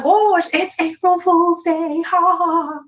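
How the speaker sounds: slow mocking sing-song; sarcastic sing-song. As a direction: drag out a mocking "ha ha ha". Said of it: "I'm like".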